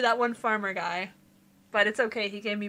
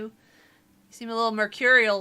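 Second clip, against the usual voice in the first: ordinary talk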